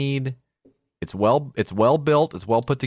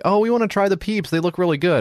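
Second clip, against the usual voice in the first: put-on light, higher kid voice, eager; dad voicing his kids asking to try peeps, eager; kids pleading; 6 semitones higher than usual.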